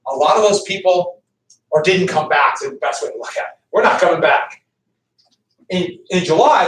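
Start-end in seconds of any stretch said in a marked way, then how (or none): none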